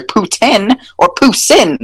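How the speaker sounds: "poutine" as poo-TIN, "poussin" as poo-SIN, high; local pronunciations. Shown as a demonstration. Advising people how to order, local pronunciations. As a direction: pitch up "poutine" and "poussin", short vowels at the end. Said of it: "you should say".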